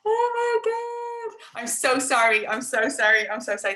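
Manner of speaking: embarrassed high laughing apology; gasp, then giggle through the sorrys; mortified. Real, not acted. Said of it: "I'm mortified".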